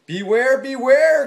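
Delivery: loud high warning call. Acted. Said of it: "called the bees".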